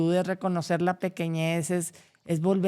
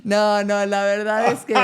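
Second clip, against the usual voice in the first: words broken by laughter, higher and louder; laughing; 4 semitones higher, 7 dB louder than usual. Real, not acted. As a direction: try to go on while laughing. Still laughing.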